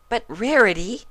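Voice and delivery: high, urgent interjection; Pinky interjecting. A narrator's reading. Pinky trying to get a word in, high. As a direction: cut in, high and pressing. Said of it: "trying to get a word in".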